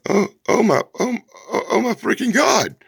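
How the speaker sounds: high, halting groaned exclamations; stammering, high-pitched disbelief; mock exasperation. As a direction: moan in overdone dismay. Mock exasperation at long letters.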